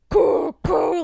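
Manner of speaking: loud, high mock knocks; loud imitated knocking. Mimicking the loud knocking from the back room. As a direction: bang out the knocks. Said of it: "and it's fucking loud".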